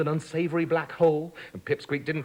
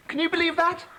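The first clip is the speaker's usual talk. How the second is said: incredulous; 11 semitones higher than usual. Real, not acted. Narrator incredulous aside.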